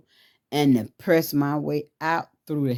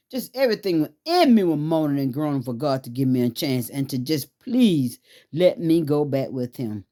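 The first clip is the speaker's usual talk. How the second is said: ordinary talk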